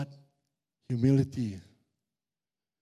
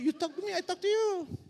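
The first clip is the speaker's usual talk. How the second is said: pitched much higher, a little softer; high squeaky retort; 13 semitones higher, 3 dB quieter than usual. Retold or imitated. Pastor answering the addict in a high mock voice. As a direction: squeak it out playfully.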